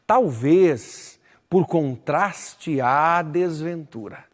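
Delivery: very slow, syllable by syllable; slow scansion. Shown as a demonstration. Teacher scans the verse for the students, slowly. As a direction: count each poetic syllable out slowly. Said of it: "Lerei um verso só".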